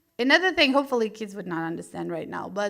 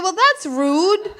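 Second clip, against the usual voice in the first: indignant thought; 4 semitones higher, 7 dB louder than usual. Retold or imitated. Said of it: "I was thinking".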